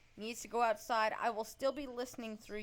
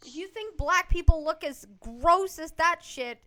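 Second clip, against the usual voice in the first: angry; 5 semitones higher, 10 dB louder than usual. Real, not acted. Angry rant about race.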